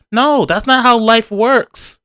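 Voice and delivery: high-pitched, drawn-out mock indignation. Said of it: "he doesn't raise his voice".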